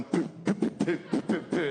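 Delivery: breathy poo poo heartbeat beats, half laughing; imitated pounding heartbeat. His heart racing on the hill, puffed heartbeat noises. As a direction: mimic a racing heart with puffs. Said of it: "my heart starts going".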